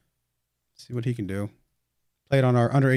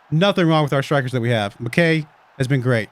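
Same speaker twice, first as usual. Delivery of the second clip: normal talking voice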